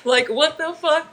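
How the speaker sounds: high laughing disbelief. Real, not acted. Streamer laughing at a scene, high and incredulous. Reacting to the show.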